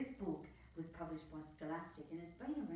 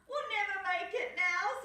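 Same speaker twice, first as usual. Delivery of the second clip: high, louder despairing cry; despairing Skip; 13 semitones higher, 8 dB louder than usual. Acted. Skip giving up in the still sea, high loud despair. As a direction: cry out that it's hopeless. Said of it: "said Skip".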